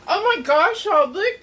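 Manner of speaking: surprised. Real, not acted. Burned arm on waffle iron.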